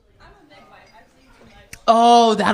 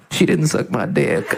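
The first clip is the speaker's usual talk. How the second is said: Bill Clinton voice; 9 semitones lower than usual. Retold or imitated. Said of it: "Bill Clinton being like".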